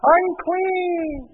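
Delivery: high, loud call of unclean; high wailing call of unclean; leper's cry. Retold or imitated. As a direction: cry out a warning from far off. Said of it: "They would have to yell out".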